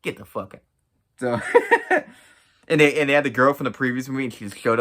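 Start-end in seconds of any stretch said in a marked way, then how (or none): 1.4-2.0 a short high laugh breaks out; a quick real laugh; burst of laughter. Real, not acted. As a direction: laugh out loud at the joke. Laughs at own remark.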